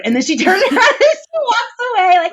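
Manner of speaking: high laughing narration. Real, not acted. Mother retelling her daughter's exit, laughing high. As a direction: tell it through a burst of laughter.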